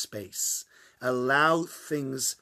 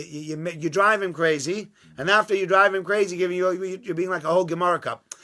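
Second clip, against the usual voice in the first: usual speaking voice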